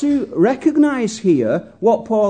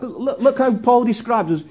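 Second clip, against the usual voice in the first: ordinary talk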